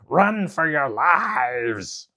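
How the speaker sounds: loud, very slow frightened wail; panicked demons. Acted. Demons flee the pecking pheasant, slow panicked wail. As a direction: stretch out the panic. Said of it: "the demons cried".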